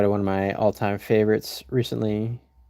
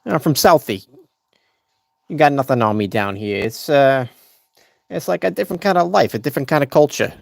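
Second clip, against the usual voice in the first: a loose Southie guy bragging; a hint of a Boston tough-guy accent. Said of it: "To Boston?".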